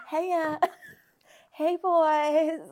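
breaking into giggles mid flirty greeting; half-hearted sexy voice, giggling; laughing through a mock sexy voice. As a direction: try to sound sultry but crack up. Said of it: "oh, I'm doing it now".

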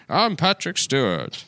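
slow, flat name-only impression; deadpan fake impression; bad Patrick Stewart impression. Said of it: "he's like".

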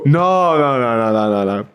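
emphatic opening no, tumbling downwards; protesting string of noes; hasty denial. Real, not acted. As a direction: deny it in a falling run. Rapid denial of teasing accusation.

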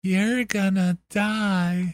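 slow creepy voice. Shown as a demonstration. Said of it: "or like a".

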